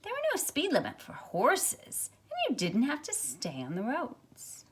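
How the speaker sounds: put-on lively, expressive read-aloud for children; storybook reading. A narrator's reading. Teacher reads picture book aloud.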